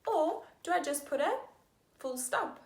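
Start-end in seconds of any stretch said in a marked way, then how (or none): none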